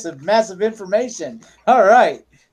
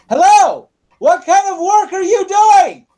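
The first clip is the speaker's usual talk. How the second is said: loud, high-pitched deaf man's holler; booming over-loud greeting from a deaf clerk; hard-of-hearing shouting; 13 semitones higher, 6 dB louder than usual. Acted. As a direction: shout it as if you can't hear yourself. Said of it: "why is this person talking like this".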